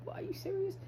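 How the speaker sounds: high, soft disbelieving question; mock yourself in disbelief; incredulous self-mockery. Laughing at oneself, quoted.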